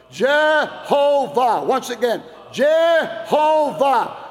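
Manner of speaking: slow, high, loud Jehovah drawn out; shouted call. Shown as a demonstration. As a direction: lead the crowd: Jehovah stretched long and loud. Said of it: "Let's say that together".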